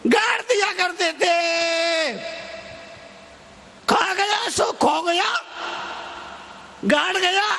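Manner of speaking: high, ringing, drawn-out call; emphatic preaching cry. Real, not acted. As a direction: proclaim it to a big crowd. Preacher calls saying to crowd. (ऊँची आवाज़ में लंबी पुकार)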